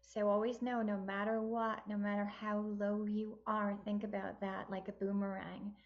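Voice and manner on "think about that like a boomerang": usual speaking voice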